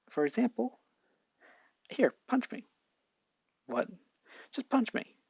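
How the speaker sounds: put-on high, excited boy voice; eager Izuku. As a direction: dare him cheerfully. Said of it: "Izuku showing his gadget".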